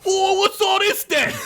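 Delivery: high silly orc yell. Acted. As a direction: bark it out as a rowdy orc. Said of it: "yelled one orc".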